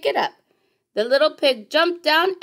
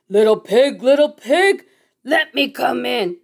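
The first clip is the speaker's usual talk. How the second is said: wolf calling; 5 dB louder than usual. Acted. Wolf voiced at the door.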